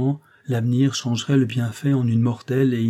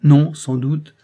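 loud, raised-pitch interjection; interrupting; 5 semitones higher, 8 dB louder, much slower than usual. A narrator's reading. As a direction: cut in firmly on the other speaker. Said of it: "interrompit André". (interruption vive, voix plus forte)

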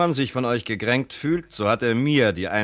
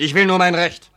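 higher, louder insistence; insistent; 7 semitones higher, 8 dB louder than usual. Acted. Son-in-law insisting on his right to the house, louder. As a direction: hold your ground hard.

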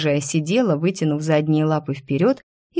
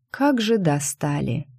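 ordinary delivery, nothing marked

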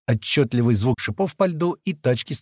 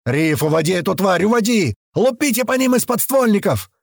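urgent high shout, climbing; shouted orders; 8 semitones higher, 3 dB louder than usual. A narrator's reading. Fighter ordering Риф to lead the beast away, shouting. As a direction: holler commands over gunfire.

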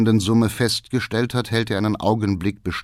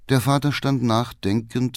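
ordinary delivery

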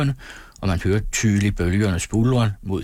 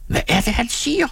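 very high-pitched outraged question; woman's indignant shriek. A narrator's reading. Insulted woman reacting to her man, high indignant shriek. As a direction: screech it high, without full volume. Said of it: "skreg konen".

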